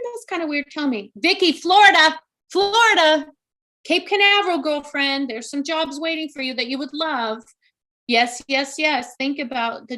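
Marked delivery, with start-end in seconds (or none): 1.2-4.6 loud, high insistent naming; emphatic calling out. Real, not acted. Medium urging Wendy toward Florida, loud and insistent. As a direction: call out the places with urgency.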